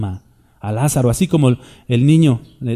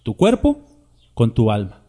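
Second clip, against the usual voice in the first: usual speaking voice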